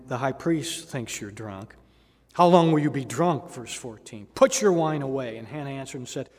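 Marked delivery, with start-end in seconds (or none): 2.3-3.5 louder scolding priest; stern rebuke. Retold or imitated. High priest rebukes Hannah. 4.3-5.4 louder scolding priest; stern rebuke. Retold or imitated. High priest rebukes Hannah.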